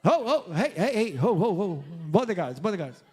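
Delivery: panicked calling out. Pretend. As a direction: cry out in panic for help. Disciples in panic call bodyguards.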